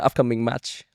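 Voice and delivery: usual speaking voice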